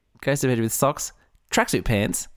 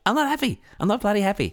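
mock indignation; 10 semitones higher, faster than usual. Mock complaint about own grievance.

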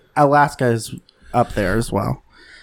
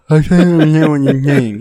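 mock deaf voice; 4 dB louder than usual. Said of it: "I almost just did it again".

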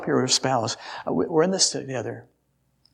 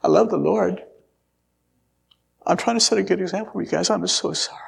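whispered apology breaking into a higher, choked plea; retold sobbing apology; 6 semitones higher than usual. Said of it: "he was sobbing and sobbing".

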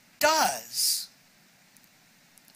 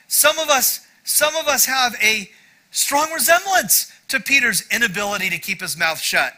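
ordinary talk

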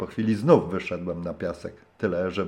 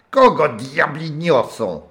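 put-on gruff old man's bark, high and loud; a grumpy old skipper disturbed at his door; hoarse old man; 7 semitones higher, 6 dB louder, slower than usual. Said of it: "słyszę zachrypnięty głos". (zachrypnięty głos starego zrzędy)

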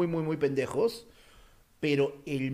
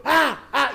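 two short, loud, high yells; brief mock terrified screams; re-enacted nightmare scream; 9 semitones higher, 8 dB louder than usual. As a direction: yell twice, re-enacting a nightmare. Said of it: "yo como huevón seguía".

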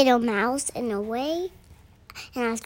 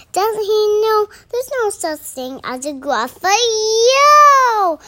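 loud exclamation; 9 semitones higher, 7 dB louder than usual. Acted. Mouse mocking the snake's belief, loud exclamation. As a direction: call it out high and loud. Mouse scoffs loudly in recited story.

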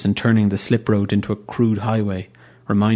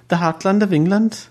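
"The heartland of England?" thrown up high, rising on "England"; incredulous echo; 11 semitones higher than usual. Acted. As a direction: echo it in disbelief, climbing on "England".

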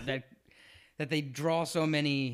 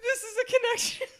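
laughing; 4 dB louder than usual. Real, not acted. Joking about the Rocky link.

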